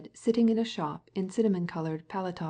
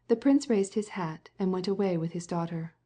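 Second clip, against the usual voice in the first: usual speaking voice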